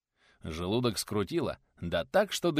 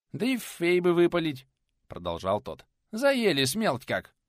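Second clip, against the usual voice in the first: high, loud lisping peasant; toothless lisping craftsman; 8 semitones higher, 4 dB louder than usual. Acted. Nikodim arguing about drying bricks, high lisping exasperation.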